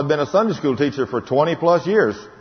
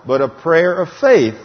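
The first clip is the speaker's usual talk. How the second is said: ordinary talk, nothing marked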